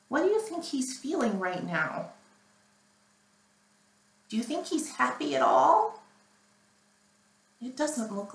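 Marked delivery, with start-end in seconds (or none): none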